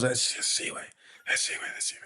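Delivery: barely voiced, repeated whisper; Vito. Imitating a friend identifying a woman at the table, whispering. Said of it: "Vito's whispering".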